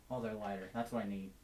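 ordinary delivery

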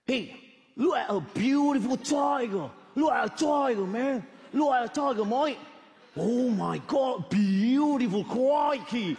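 high-pitched, hammed-up Australian; Australian slang accent. Shown as a demonstration. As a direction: play an excited Australian wildlife spotter. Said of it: "an Australian English slang".

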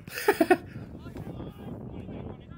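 a high, airy giggle under the breath; laughing at his own dig at Connor; breathless laugh. Real, not acted. Commentator laughing after teasing Connor, airy giggle.

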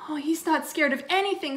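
put-on high, enthusiastic child; eager little boy. A narrator's reading. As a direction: boast about your hero. Twin praising babysitter.

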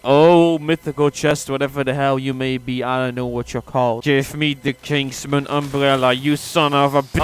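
low, hushed, solemn mock prayer. Gamer praying to a loot chest, mock-solemn prayer. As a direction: intone it softly like a prayer. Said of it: "Let's all put our hands together and pray".

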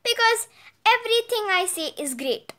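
smug little boast; boastful. A narrator's reading. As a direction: show off with easy certainty. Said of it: "boasted Turner".

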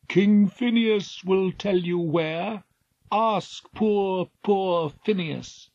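high, slow, otherworldly voice of a carved figurehead; eerie figurehead voice. Acted. Magic figurehead guiding the Argonauts, high and eerie. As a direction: let the wood itself speak, high and drawn out.

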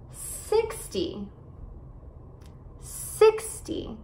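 SIXTY with SIX high, ending falling; careful model of SIXTY, weight on the start; SIX-ty, light last syllable in SIXTY; stress on first syllable. Shown as a demonstration. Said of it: "enfatizar as sílabas no começo".

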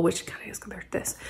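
a half-whispered confession under the breath; whispered aside. Real, not acted. Muttered aside about the book.

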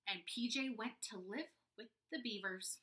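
usual speaking voice

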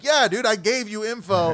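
fed-up host reminding a guest he was told; exasperated. Retold or imitated. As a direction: tell them you already warned them. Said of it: "I'm like".

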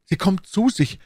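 high, excited cry; ausgerufen. A narrator's reading. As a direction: exclaim it with sudden hope. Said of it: "rief der junge Mann". (hoch und aufgeregt gerufen)

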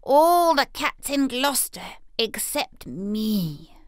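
a hint of a jealous tomcat; envious cat. A narrator's reading. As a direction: sulk it as a left-out cat. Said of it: "said Simpkin".

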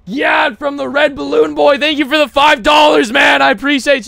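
loud, high shouted shout-out; hyped thanks. Real, not acted. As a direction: holler your gratitude to a donor. Thanks donor loudly.

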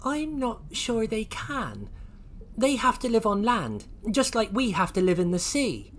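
high, innocent explaining voice; Oisin. Acted. Oisin explains dinosaurs to the Lloyds, pitched high. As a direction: reason it out in a high little voice. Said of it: "said Oisin".

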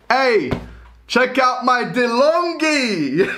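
high, drawn-out cheeky boast; mock show-off. Showing off a rude-sounding machine name, as a mock boast. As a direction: call it out high, breaking into laughter. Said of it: "Imagine, like".

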